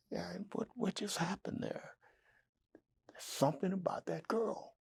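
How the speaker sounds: high hushed whisper to himself; amazed whisper. Retold or imitated. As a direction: marvel under your breath. Walking back, amazed, to himself.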